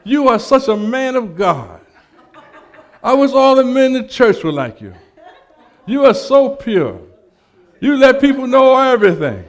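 gushing church lady. Retold or imitated. Church lady greets the man hiding his magazine, gushing loudly. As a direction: imitate a church lady lavishing admiration. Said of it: "she looked at me and said".

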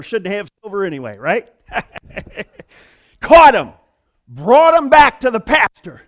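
ordinary delivery

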